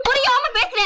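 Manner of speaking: ordinary delivery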